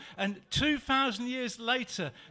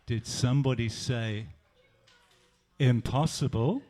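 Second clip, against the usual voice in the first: Jesus' voice; 8 semitones lower than usual. Acted. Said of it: "Jesus said something to Tom".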